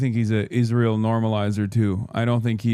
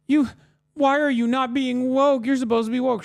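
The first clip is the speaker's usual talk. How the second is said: high, slow whiny mockery of an upset fan; mocking whiny fan; 12 semitones higher than usual. Streamer mocking crying fans in a high whiny voice. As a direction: whine it out like a crybaby fan, much higher. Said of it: "They're like".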